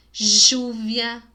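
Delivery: "júvia" with a loud zh onset; regional variant. Shown as a demonstration. Teacher contrasting regional accents, emphatic demonstration. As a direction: hit a buzzing zh to open "júvia". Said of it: "Tem vários jeitos de falar".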